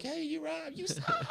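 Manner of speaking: jokey falsetto insult through a laugh; squeaky mocking voice. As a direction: squeal it like a cartoon troll. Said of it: "It's like".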